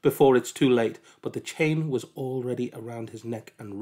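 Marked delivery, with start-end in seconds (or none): 0.0-1.0 loud burst on the first word; sudden loud alarm; urgent warning. A narrator's reading. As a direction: cry out a warning to halt him. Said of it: "a voice inside him said".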